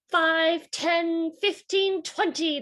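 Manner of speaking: high, loud cheering count. Acted. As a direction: cheer each number like excited kids. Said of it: "they cheered".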